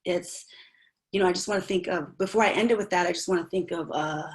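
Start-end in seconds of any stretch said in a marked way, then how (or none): none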